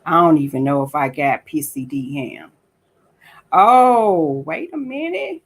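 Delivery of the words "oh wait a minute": high, startled "Oh, wait a minute"; sudden surprise. Real, not acted. Noticing something while looking up a post, surprised. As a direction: catch yourself, suddenly surprised.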